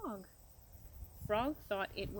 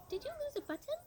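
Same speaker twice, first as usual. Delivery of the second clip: much higher, like a small bird; sparrow voice; 11 semitones higher, faster than usual. A narrator's reading. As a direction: pipe it up high like a polite little sparrow. Sparrow offers a button.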